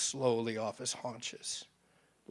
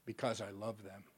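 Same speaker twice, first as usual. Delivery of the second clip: lower, much softer, tender; deep and soft; 6 semitones lower, 11 dB quieter than usual. A narrator's reading. The Father answering Satan's why, deep and soft. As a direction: say it gently, like a simple truth. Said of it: "The Father's voice was deep and soft".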